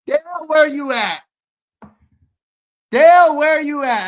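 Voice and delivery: loud hype call. Real, not acted. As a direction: call him out across the room. Calling the drawn name.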